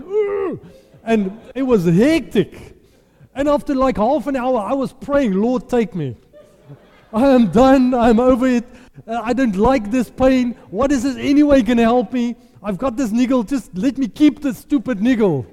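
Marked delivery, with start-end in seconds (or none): 0.0-0.6 pained grunt. Retold or imitated. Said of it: "I went". 5.3-15.4 desperate prayer. Retold or imitated. Praying in pain during massage.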